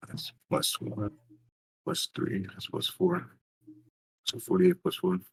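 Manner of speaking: unvoiced murmured arithmetic; muttering sums. Real, not acted. Player adding up damage to himself, whispered. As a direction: count the dice under your breath. Adding dice under breath.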